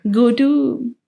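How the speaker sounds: ordinary delivery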